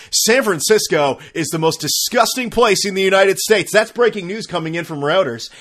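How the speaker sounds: hammed-up anchor, loud and high; mock news anchor. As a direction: announce it like a dramatic newscaster. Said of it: "you go on the news, it's just like".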